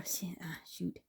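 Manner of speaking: whispered, almost no voice; whispered dismay. Real, not acted. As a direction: breathe it under your breath after a slip. Small mistake while crafting.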